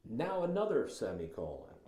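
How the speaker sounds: ordinary talk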